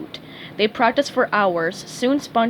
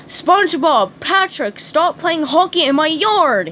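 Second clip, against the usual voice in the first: loud, higher angry shout; fed-up neighbour yelling; 6 semitones higher, 5 dB louder than usual. A narrator's reading. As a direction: yell at the kids in the yard. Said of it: "he yelled".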